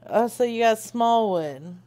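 ordinary talk

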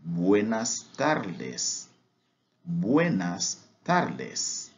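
"Buenas tardes" drawn out, very slow; teacher repeats "Buenas tardes" very slowly to model it; very slow drill. Shown as a demonstration. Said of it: "drilled repetition".

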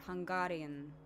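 "Hungarian" with a long, open A; extended A in Hungarian. Shown as a demonstration. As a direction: stretch the middle A of "Hungarian". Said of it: "extend the A's a little bit more".

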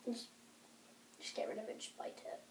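unvoiced whisper "just bite it". Real, not acted. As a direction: whisper the urging. Urging quietly off mic.

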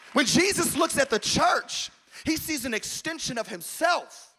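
loud, driving preacher's cadence; full-force preaching. Acted. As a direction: preach it out to the whole room. Preaching build.

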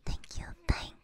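breathy unvoiced whisper throughout. Real, not acted. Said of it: "I have to whisper".

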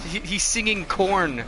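normal talking voice

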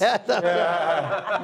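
can't hold back a laugh while answering. Real, not acted. Laughing at the host's joke.

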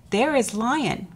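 plain reading voice

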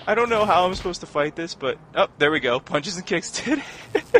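loud, excited commentary under attack; flustered fighting. Real, not acted. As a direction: talk loudly through the scramble of a fight. Panicked fighting in game.

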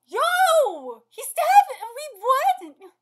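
high, very loud drawn-out shout; loud, stunned fan reaction; shocked yelling. Real, not acted. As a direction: shout in total disbelief. Shocked by a stabbing in story.

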